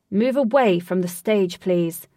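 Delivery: loud firm command from a guard; stern guard order. Acted. Guard warning visitors away from the stage, loud and firm. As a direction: push them back from the stage.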